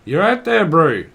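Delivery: loud, high Australian greeting; hammed-up Aussie accent, swooping up high. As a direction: call it out like an Australian. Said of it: "Someone's from Australia".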